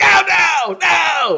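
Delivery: high, loud panicked cry of no; mock panicked shout. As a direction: yelp it like he can't take it anymore.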